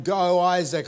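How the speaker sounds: retold yelling. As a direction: cheer on your son, re-enacting the yell. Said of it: "I'm yelling".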